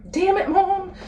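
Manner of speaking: choked up, voice jumping high; real tearful. Real, not acted. Daughter blames mom as tears come, tearful and high. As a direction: fight back tears, pitch climbing sharply. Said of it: "Now I wanna cry".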